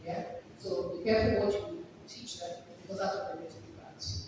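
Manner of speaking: ordinary talk, nothing marked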